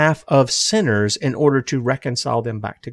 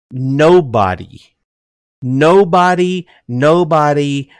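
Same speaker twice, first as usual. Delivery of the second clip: each nobody slow and louder, the second highest; emphatic repetition; 2 semitones higher, 3 dB louder, slower than usual. Real, not acted. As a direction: hammer the word nobody home.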